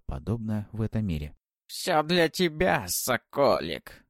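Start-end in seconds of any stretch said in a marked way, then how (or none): 1.7-4.0 высокий медленный скрипучий голос старухи; проскрипи, как старая ведьма; скрипучий голос Бабы-Яги. Acted. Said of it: "раздался скрипучий голос". (скрипучий старушечий голос)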